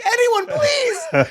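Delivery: high, drawn-out hammed-up pleading; imitated exasperated call for help; mock desperate plea. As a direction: beg the room slowly, half laughing. Acting out helper's desperate plea.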